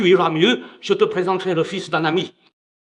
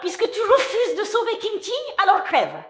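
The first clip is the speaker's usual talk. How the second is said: high-pitched venomous threat; vengeful curse. Acted. Rebuffed woman to the man who refuses, vengeful. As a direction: curse him in a high voice, dropping on the last word.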